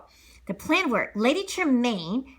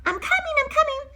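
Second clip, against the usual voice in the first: high hurried reply; 3 dB louder than usual. Acted. Cinderella answering call.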